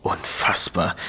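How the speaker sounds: breathless whisper of disbelief; soft unvoiced gasp of outrage; shocked whisper. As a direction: hush it out, aghast. Shocked reaction to murder rumour.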